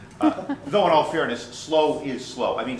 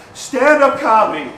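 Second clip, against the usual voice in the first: much louder, higher hawking shout; loud barker call; 9 semitones higher, 11 dB louder than usual. Shown as a demonstration. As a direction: shout it across Times Square. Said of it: "I shout my sales pitch".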